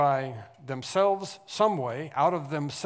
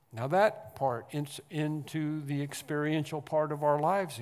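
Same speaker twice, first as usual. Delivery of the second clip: louder, building preacher's cry; rising preaching; 5 dB louder than usual. Acted. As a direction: lift it to the throne. Preaching at full force again.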